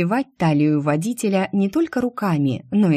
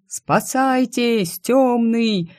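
put-on scared young clerk crying out; slow drawn-out alarm call; panicked shout; 6 semitones higher, much slower than usual. A narrator's reading. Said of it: "А крик".

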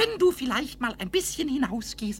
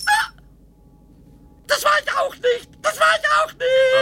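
rising shouted denial, high and loud; loud protest; 10 semitones higher, 7 dB louder than usual. Acted. Pumuckl shouts his innocence to Eder, shrill. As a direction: yell it back twice, each louder.

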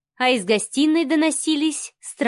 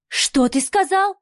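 higher, drawn-out stunned question; breathed-out question; 7 semitones higher, slower than usual. A narrator's reading. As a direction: ask it slowly, pitch lifted, on an outbreath. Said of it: "выдохнула Марианна". (вопрос на выдохе)

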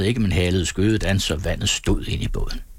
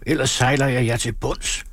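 higher, altered voice, mild yell; acted bawled threat; 5 semitones higher than usual. A narrator's reading. Helmsman warning he will sink the crew, restrained yell. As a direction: threaten them in a raised pitch. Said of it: "vrælede Gustav".

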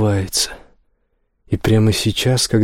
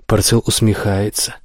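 ordinary delivery, nothing marked

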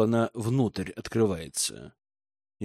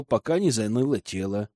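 ordinary delivery, nothing marked

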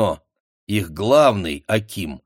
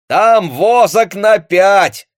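high, loud, slow happy call; joyful shout; 11 semitones higher, 10 dB louder, much slower than usual. A narrator's reading. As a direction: shout the good find. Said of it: "радостно крикнул лопоухий парень". (радостный крик)

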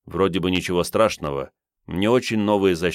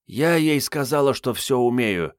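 thoughtful; 7 semitones higher than usual. A narrator's reading. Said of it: "задумчиво сказала Мариетта".

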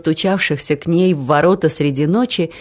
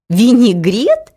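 «Винегрет?» drawn out slowly, higher and louder, rising; disbelieving; 5 semitones higher, 5 dB louder, slower than usual. A narrator's reading. Лариска doubting her ears, «Винегрет?» slow and disbelieving. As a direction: stretch «Винегрет?» into an incredulous question. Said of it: "не поверила своим ушам".

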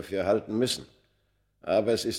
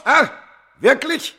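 high, loud scornful wirklich; mocking question; 11 dB louder than usual. The knife-man mocking Smith's plea, high scornful question. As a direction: sneer it back at him.

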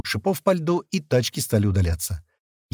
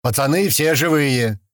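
radio announcement; 2 semitones higher, slower than usual. A narrator's reading. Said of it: "сказал в рацию Слива".

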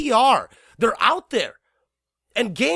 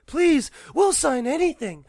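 pleading; 7 semitones higher than usual. Retold or imitated. Mock desperate publisher plea.

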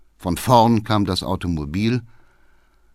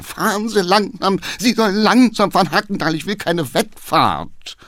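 shouting; 9 semitones higher than usual. Acted. Councillor yelling at the racing coachman. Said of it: "schrie der Geheimrat".

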